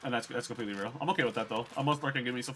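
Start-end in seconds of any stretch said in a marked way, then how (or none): none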